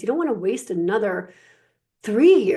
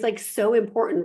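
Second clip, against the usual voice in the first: usual speaking voice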